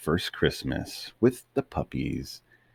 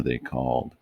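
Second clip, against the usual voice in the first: usual speaking voice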